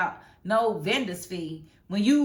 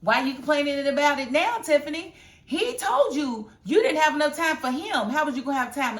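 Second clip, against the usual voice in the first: louder, higher scolding; 5 semitones higher, 5 dB louder than usual. Real, not acted. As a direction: call her out, getting heated. Scolding Tiffany.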